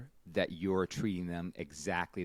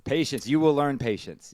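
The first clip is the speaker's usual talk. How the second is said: mock sage advice; 7 semitones higher, 10 dB louder than usual. Mock wise advice to uncle.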